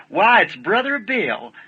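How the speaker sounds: slow, high, drawn-out greeting of delighted surprise; glad surprise. Retold or imitated. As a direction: stretch it out, the mother pleased to see him. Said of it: "like that".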